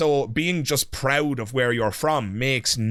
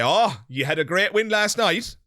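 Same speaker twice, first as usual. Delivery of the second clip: higher, chirpy congratulation; mock congratulating; 6 semitones higher than usual. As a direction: play the eager colleague who assumes you care. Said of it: "and I say".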